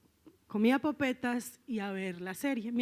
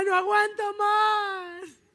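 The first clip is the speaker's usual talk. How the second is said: high, drawn-out wail to a parent; imitated loud tearful bellow; bawling; 8 semitones higher, 5 dB louder, slower than usual. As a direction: re-enact breaking down, crying out in desperation. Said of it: "sino bramando".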